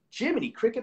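hiccuping mid-sentence; talking through hiccups. Real, not acted. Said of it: "I got the hiccups so bad today".

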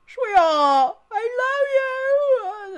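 drawn-out, high, loud mock wail of love; wailing melodramatic declaration; mock lovesick cry. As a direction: gush it loud and high, hammed-up. Mock-sentimental gushing to game character.

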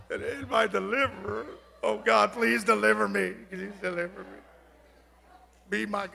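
soft, hesitant, unsure mumble; timid, doubtful. Shown as a demonstration. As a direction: say it like you barely believe it. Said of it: "You can't say it".

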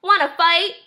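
high, rising challenge; lightly voiced scrappy bug; grouchy ladybug. A narrator's reading. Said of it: "said the grouchy ladybug".

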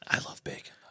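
soft unvoiced whisper of a joke; whispered aside. Real, not acted. Cohost whispering a joke about bacon, soft whisper. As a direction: breathe it under your breath. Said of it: "whispered aside".

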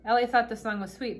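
ordinary delivery, nothing marked

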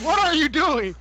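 mock high yell at a teammate; imitating Bear. Retelling Bear cussing out Pancake, mock high yell. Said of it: "Bear, like".

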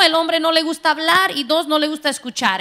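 usual speaking voice

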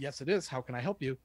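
usual speaking voice

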